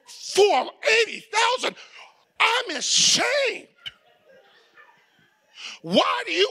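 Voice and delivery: hammed-up outraged church elder; indignant elder. Elder scolding the bishop.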